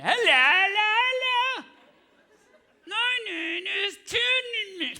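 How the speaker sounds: high, slow mumbled ventriloquist voice, lips still; high strained puppet voice. Pretend. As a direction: squeeze the words out without lips. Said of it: "British puppet named Timmy".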